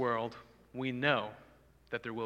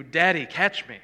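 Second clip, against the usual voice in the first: put-on louder, a little higher child's call; child crying out; 4 semitones higher, 11 dB louder than usual. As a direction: call out like a kid in the pool. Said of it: "your son cries out to you".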